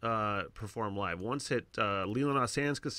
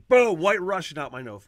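loud excited burst of retelling; 5 semitones higher, 11 dB louder than usual. Real, not acted. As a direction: blurt it out loudly, laughing at the memory. Laughing retelling of drink out nose.

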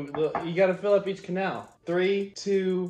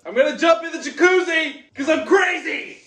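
loud, high, pumped-up yell; hyped shout; 11 semitones higher, 8 dB louder than usual. Real, not acted. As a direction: psych yourself up and shout it. Hyping himself up for jacuzzi.

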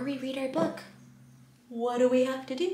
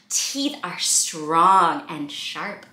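slow deliberate reading; slow picture-book voice; storybook reading; 6 semitones lower, much slower than usual. A narrator's reading. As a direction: read it to little ones. Reading book aloud slowly.

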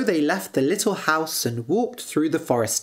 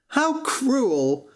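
high-pitched shocked exclamation; horrified outburst without extra volume; appalled cry; 8 semitones higher than usual. A narrator's reading. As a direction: cry out in dismay, pitch jumping up. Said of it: "cried Butcher Boy George".

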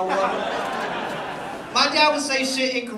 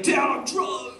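put-on drunk teenager; 4 semitones higher than usual. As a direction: imitate a drunk kid calling home, stretching the last words. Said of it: "would call their parents drunk".

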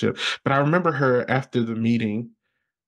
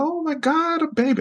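put-on high squeal over a baby; high-pitched delight; squealing excitement. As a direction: coo like a thrilled kid.

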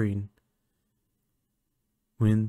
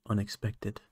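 ordinary talk

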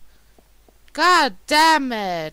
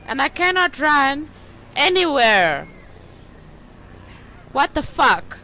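usual speaking voice

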